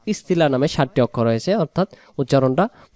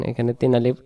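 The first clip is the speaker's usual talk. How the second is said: ordinary delivery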